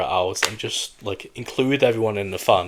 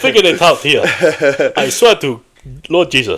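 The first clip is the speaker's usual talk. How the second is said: higher, louder mock African accent; put-on emphatic African accent; playfully hammed-up African accent; 5 semitones higher, 7 dB louder than usual. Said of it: "Let's do the rest of the podcast African".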